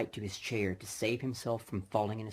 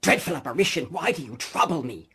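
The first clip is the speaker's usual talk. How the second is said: a touch of fright, higher and louder; frightened plea; 5 semitones higher, 9 dB louder, faster than usual. Acted. Scrooge asking the apparition in mild fright. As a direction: ask in horror.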